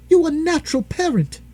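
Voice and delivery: shrill high-pitched scolding; outraged wife. A narrator's reading. Wife scolds John in high outrage. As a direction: hurl the accusation at him. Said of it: "she cried".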